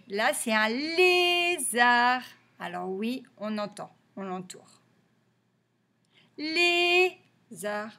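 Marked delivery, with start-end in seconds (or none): 0.7-2.2 lézard slow and loud, both syllables held; sustained LÉ so the sound stands out; drawn-out syllables. Shown as a demonstration. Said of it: "chercher si on entend". 6.4-7.9 Lézard split, LÉ high and held; long LÉ, gap, then ZARD; syllable by syllable. Shown as a demonstration. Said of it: "donc dans le premier".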